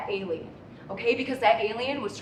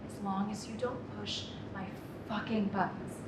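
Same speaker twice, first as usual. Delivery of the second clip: quiet threat; 4 semitones lower, 9 dB quieter than usual. Acted. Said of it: "kind, patient voice".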